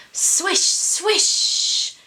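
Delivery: high breathy swish of a whale spout; whoosh sound effect. A narrator's reading.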